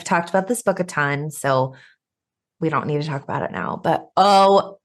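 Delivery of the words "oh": loud burst of excitement; shouting into the mic. Real, not acted. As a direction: blurt it out loud. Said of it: "I'm shouting into my mic".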